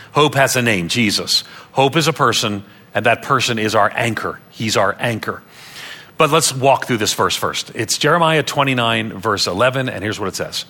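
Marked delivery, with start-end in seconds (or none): none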